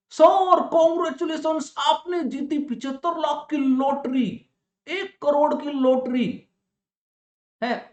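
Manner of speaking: high, slow hyped-up announcement; excited lottery caller. Retold or imitated. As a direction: sell the big win like a prize host.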